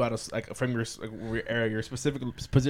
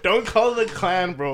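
amused protest; 5 semitones higher, 4 dB louder, much slower than usual. Real, not acted. Laughing protest at friend's joke.

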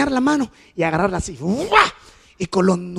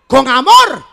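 with love, said loud and mocking; loud ironic; 6 semitones higher, 11 dB louder, slower than usual. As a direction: hammer the irony home. Ironic aside after spanking scene.

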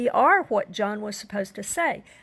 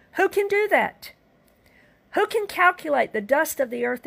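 preached question; 6 semitones higher than usual. Real, not acted.

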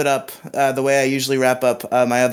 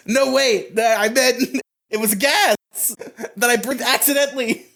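flustered excuse; 8 semitones higher than usual. Retold or imitated. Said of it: "He's like".

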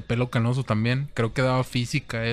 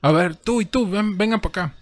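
stern teacher call; 9 semitones higher than usual. Retold or imitated. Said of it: "y dice".

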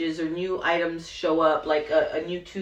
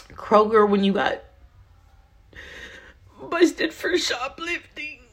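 halting, higher-pitched, on the verge of tears; choked up; 5 semitones higher than usual. Real, not acted. Speaker realizes what her husband went through, choked up. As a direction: struggle to get the words out through emotion.

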